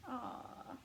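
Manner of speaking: normal talking voice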